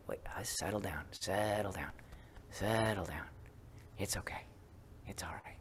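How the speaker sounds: gently calm a startled self; soothing settle down. Retold or imitated. Calming her own knee-jerk reaction.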